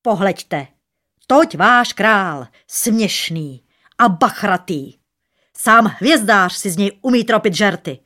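loud, scornful proclamation; furious. A narrator's reading. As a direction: announce the mockery angrily to the yard. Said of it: "v lítém hněvu". (rozlíceně, hlasitě)

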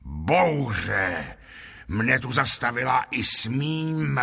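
slow, drawn-out fairground patter, rising on the lists; boastful showman. Acted. Lotrando boasts of his puppets to the lord, boastful showman. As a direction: sell the marvels with slow relish.

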